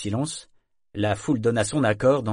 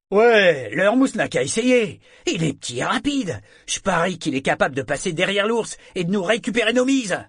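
high, excited crowd heckler; eager egging-on; 9 semitones higher, 5 dB louder than usual. Acted. Sailor in crowd bets the cabin boy can do it, excited. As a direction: call out the idea eagerly, pitched up. Man in crowd takes up proposal.

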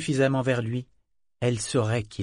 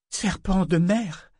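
higher, slow, startled exclamation; sudden exclamation; 7 semitones higher, slower than usual. Acted. Amber suddenly catching on to the words, surprised exclamation. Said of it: "s'exclama-t-elle soudain".

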